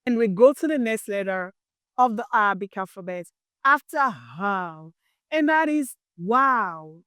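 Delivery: ordinary talk, nothing marked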